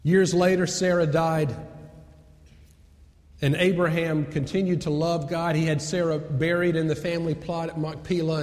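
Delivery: normal talking voice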